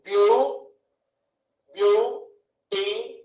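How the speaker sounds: బ్యూ first, then బ్యూటిఫుల్ slowly as "బ్యూ... టి... ఫుల్"; slow, separated beats of బ్యూటిఫుల్; three syllables. Shown as a demonstration. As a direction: pause between each syllable of బ్యూటిఫుల్. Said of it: "ఎన్ని వచ్చినాయి".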